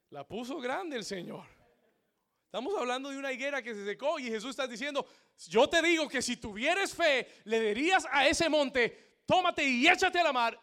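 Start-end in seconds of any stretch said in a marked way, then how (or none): 5.5-10.6 high, loud proclamation; preacher quoting scripture at full power; full-force preaching. Acted. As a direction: thunder it out to the congregation.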